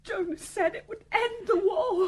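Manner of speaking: tearful. Acted. Mother confesses, breaking down.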